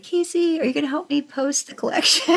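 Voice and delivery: high, laughing mock plea; mock pleading ask. Podficcer retelling how she asked the writer for help, high and laughing. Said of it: "and I was like".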